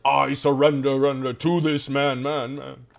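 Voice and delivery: loud, echoing canyon voice; booming echo. Acted. GM voices the player's roaring echo in the canyon, booming. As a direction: roar it out with a ringing echo. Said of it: "you guys hear Beaufort's voice".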